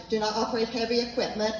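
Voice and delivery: ordinary delivery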